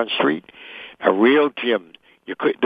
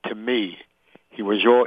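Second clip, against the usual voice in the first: ordinary talk, nothing marked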